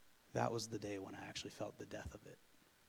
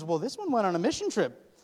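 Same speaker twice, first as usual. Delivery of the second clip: much higher, louder mock mother voice; mom's question; 8 semitones higher, 12 dB louder than usual. Mom to son pacing, high loud mock-mother question. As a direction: play the amused mom asking.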